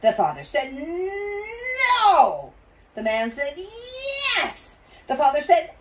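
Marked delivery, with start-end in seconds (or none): 0.7-2.5 long, rising, loud refusal; stubborn shout. A narrator's reading. Father argues with the cashier, stubborn shout. 3.6-4.8 long, high, climbing retort; stubborn retort. A narrator's reading. Cashier argues back at father, stubborn and high.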